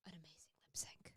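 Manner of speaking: whispered so as not to spoil. Real, not acted. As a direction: hush it like a secret. Hushed to avoid spoiling.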